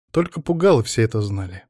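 plain reading voice

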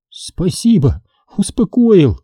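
a teary little smile in the voice, lifting high on the last word; grateful and tearful, half laughing; smiling through tears. A narrator's reading. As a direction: thank him wryly while still crying. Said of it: "сквозь слёзы улыбнулась Лариса".

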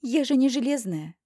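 huffy, indignant outburst. Acted. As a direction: huff at him indignantly. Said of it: "С негодованием пыхчу на него".

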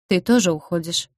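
plain reading voice

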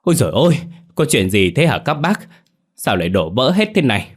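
high, loud startled exclamation; shocked cry. A narrator's reading. Neighbour arrives at the gate, shocked cry. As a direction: gasp it out in alarm. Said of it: "tá hỏa".

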